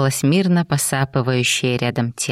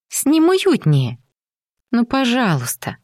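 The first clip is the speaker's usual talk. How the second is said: put-on pleading girl; 9 semitones higher than usual. A narrator's reading. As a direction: coax him sweetly. Jenny begging him.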